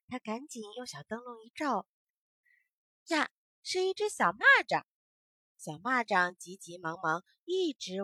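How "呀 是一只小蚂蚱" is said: a high, loud little gasp of discovery; surprised discovery. A narrator's reading. Storyteller spots the grasshopper, high and loud surprise. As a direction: exclaim as if spotting it suddenly.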